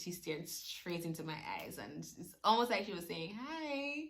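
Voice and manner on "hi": soft, much higher little hi; a wee high greeting; tiny baby hi. Pretend. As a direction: voice the newborn saying hi.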